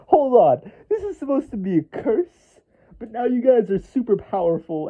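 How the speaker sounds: high-pitched, indignant disbelief; hysterical protest. Acted. Curse monger confronts the vampires he cursed, hysterical protest. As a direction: object in a high, incredulous voice. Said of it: "imagine his hysterical reaction".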